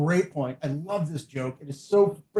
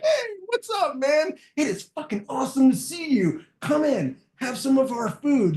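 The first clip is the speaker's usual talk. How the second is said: much higher, bright mock greeting to a guest; excited welcome; 7 semitones higher than usual. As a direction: throw the door open and greet an old friend, imitating. Warm greeting to a visitor.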